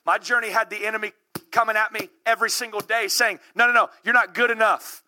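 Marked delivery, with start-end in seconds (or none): none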